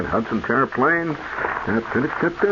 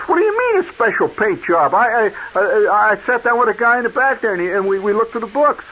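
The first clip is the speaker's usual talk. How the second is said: indignant protest; 9 semitones higher than usual. Retold or imitated. Young customer protesting the hidden charge to the attendant.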